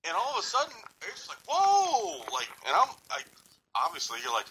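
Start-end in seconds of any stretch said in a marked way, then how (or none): 1.5-2.2 startled yelp. Retold or imitated. Retold shock at exam.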